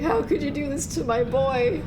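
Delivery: ordinary talk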